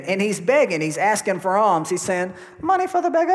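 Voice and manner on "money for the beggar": high-pitched put-on beggar's cry. Beggar asking the disciples for alms, high put-on voice. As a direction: call out for alms in a comic high voice. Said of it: "I don't know if that's how he sounded".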